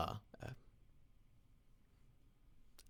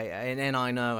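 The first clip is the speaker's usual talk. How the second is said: usual speaking voice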